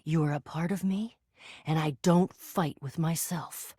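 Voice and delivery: low, composed voice; calm acceptance. Acted. Hero making peace with an alter ego, low and calm. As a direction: settle deep into calm certainty.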